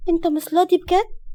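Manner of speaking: put-on high, mildly hurt child voice; sad little girl. A narrator's reading. Small daughter asking if daddy truly refuses, sad. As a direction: ask sadly, like a small child. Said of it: "وكملت بحزن".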